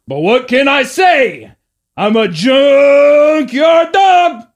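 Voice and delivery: slow, loud, high shout; very aggressive shout; junkyard dog bit. Shown as a demonstration. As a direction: bellow the catchphrase. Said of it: "So I'd say like that".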